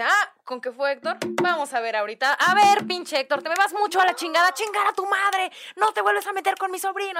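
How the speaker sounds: loud, high furious cursing at a ghost; angry uncle yelling. Retold or imitated. Uncle curses out the imaginary friend Héctor in a loud, high furious yell. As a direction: bellow curses to chase off a spirit. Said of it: "diciendo unas cosas fuertísimas".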